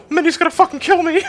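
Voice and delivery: cartoon mouse falsetto taking up the dare; Mickey Mouse voice. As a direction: shoot up into a cartoon mouse squeak. Mickey voice swearing.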